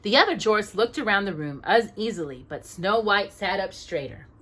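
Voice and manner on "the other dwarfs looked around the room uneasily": ordinary delivery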